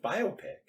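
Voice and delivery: biopic said high as BYE-oh-pick; biopic as BIO-pic. Shown as a demonstration. Co-host corrects how people say it, biopic as BYE-oh-pick. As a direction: insist on long O in biopic, stress up front. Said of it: "That's not right".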